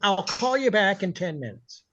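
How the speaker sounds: a touch high, curt boss voice; mock owner snapping off the call; lightly voiced gruff boss; imitating a bossy owner. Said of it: "[name] is impersonating Stan Kaplan".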